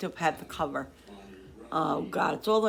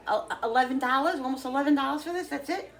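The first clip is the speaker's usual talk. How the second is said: higher, incredulous questions about the price; price surprise; 7 semitones higher, 3 dB louder than usual. Real, not acted. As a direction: sound amazed it cost so little. Amazed at the cheap price.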